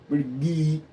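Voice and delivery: silly voice. Pretend. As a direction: goof around with an odd little voice. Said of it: "I don't know why I'm talking like that".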